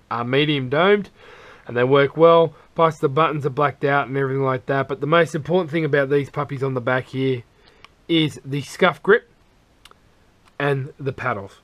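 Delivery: usual speaking voice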